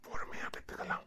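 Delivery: very soft, voiceless whisper; intimate whisper. Acted. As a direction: whisper it privately to your wife. Husband's intimate aside to wife. (ரகசியமாகக் கிசுகிசுப்பது)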